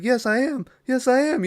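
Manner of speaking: begging hard to be believed, pitch shooting up; desperate pleading. Retold or imitated. Frightened captive pleads with the killer, high frantic mock plea. Retelling victim begging for life.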